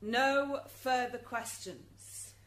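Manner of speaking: slow, emphatic courtroom dismissal; insistent cut-off. Acted. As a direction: spell it out slowly, allow no argument. Prosecutor overrides protesting witness.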